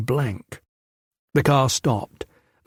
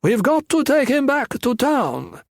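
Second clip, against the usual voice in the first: much higher, slow thug voice; shrill-voiced thug; 12 semitones higher, slower than usual. A narrator's reading. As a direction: squeak it out lazily to his partner. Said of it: "Shrill voice was on his way back".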